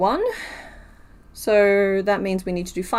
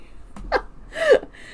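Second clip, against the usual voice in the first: a squeaky breathy giggle, much higher. Real, not acted. Streamer giggles at fan art, high squeaky laugh. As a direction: unable to hold back a high giggle.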